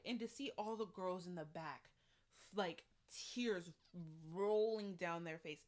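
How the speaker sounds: ordinary talk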